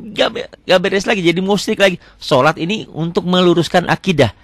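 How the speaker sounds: ordinary delivery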